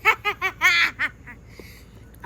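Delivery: loud staccato cackle, fading out; big mock-villain laugh; gloating laugh. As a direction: cackle loudly as if nobody matters. Villain laughs, who cares.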